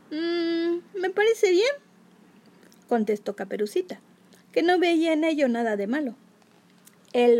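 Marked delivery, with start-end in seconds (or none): 0.1-1.7 put-on high, bright little girl. A narrator's reading. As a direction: answer like an innocent girl. Girl agrees cheerfully.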